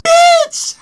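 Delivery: very high, loud yell; imagined shout. Retold or imitated. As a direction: scream it at her. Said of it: "That's what I wanted to say".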